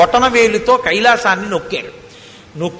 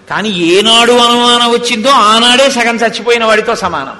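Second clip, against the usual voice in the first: forceful preaching; 5 dB louder than usual. Real, not acted. Moral point preached forcefully.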